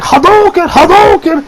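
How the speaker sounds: high mock battle cry; game attack yell. Stoned friend punching a branch karate style, high mock yell. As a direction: imitate a kid yelling a fighting game move. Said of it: "I don't know why he yells this".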